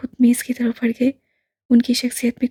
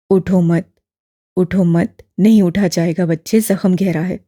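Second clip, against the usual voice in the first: put-on low, louder elderly man, gently probing; lower, fuller old gentleman; old imam voice; 6 semitones lower, 5 dB louder than usual. A narrator's reading. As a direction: voice the old man, kindly and curious. Old man stops him rising.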